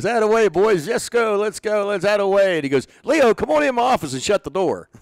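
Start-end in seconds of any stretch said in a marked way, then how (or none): 0.0-2.6 high, loud cheering manager voice; hammed-up clubhouse cheer; a lively coach rallying his boys; imitating Bobby Cox cheering. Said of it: "you know how he was". 3.0-4.9 high, loud summons; the manager calling Leo in, commanding; imitating the stern manager. Bobby voiced, summoning.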